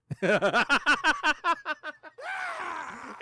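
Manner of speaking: long laugh climbing high; helpless high-pitched laughing fit; big laugh. Real, not acted. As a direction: burst into rising staccato cackles. Cracks up at support's answer.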